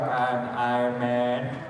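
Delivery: usual speaking voice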